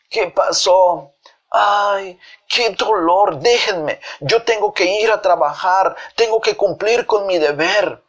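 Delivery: high, pained voice of a fake invalid; hammed-up patient insisting through pretend pain; feigned sickness. Feigns illness after coming round.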